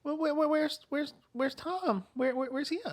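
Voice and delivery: put-on high quavering-free but faltering old lady voice; worried elderly woman. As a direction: stammer like a confused grandma. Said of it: "And she's like".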